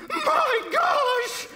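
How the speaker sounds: very high panicked scream; screaming mother. A narrator's reading. Mother clutching her children at gunpoint, high scream. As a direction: shriek it out as a terrified mother. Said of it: "the mother screamed".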